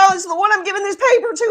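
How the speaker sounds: high, loud, fast and obvious; mock loud announcement. Joking that Jesus gave it away openly, loud mock announcement. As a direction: announce the secret to the whole room.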